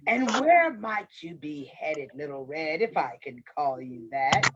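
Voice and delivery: low, courteous wolf voice; sly wolf. A narrator's reading. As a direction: probe politely as a scheming wolf.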